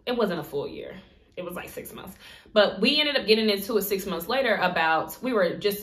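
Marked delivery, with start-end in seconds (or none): 0.1-2.2 soft whispered correction; whispered aside. Real, not acted. Correcting the timeline in a whispered aside. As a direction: toss the fix off under the breath.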